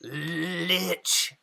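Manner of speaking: a hint of a raspy voice, muffled; lightly voiced muffled rasp. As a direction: rasp the word from inside the bag. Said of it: "a raspy voice respond".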